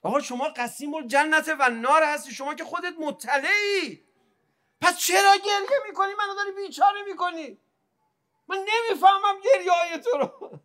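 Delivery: very high, wailing cry at full voice; anguished plea. Acted. Devotee asking the Imam why he weeps, anguished plea. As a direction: cry out in desperate, tearful bewilderment.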